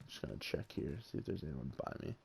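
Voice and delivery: very low, near-whispered muttering; hushed aside. Real, not acted. Checking if anyone is nearby, a hushed aside. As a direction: murmur it barely audibly, as if to yourself.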